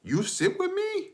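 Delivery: put-on very high-pitched little toddler voice; imitating toddler daughter. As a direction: ask it like a tiny child, squeaky high. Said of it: "and she goes".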